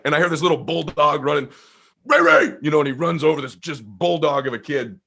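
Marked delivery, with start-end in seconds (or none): 2.0-2.5 loud higher call. Retold or imitated. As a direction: holler the name. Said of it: "calling out".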